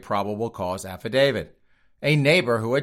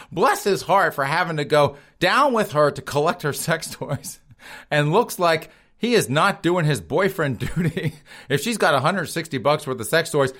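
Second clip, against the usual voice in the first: usual speaking voice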